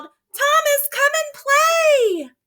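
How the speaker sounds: very high, slow calling from far away; distant yell. Acted. Friend calling from the playground, high and far-off. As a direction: call out across the playground. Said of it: "someone yelled".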